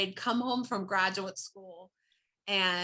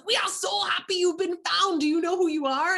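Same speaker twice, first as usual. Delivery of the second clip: excited; 9 semitones higher, 4 dB louder than usual. Retold or imitated. Uncle delighted on phone.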